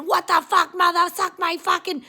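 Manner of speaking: high, loud mock Massachusetts yell; rude local yelling. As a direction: yell like a crude Boston street guy. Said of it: "they hear people saying".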